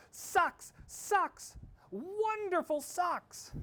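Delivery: high, loud delighted yelling; excited yell. A narrator's reading. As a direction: shout it out in pure delight. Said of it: "and yelled".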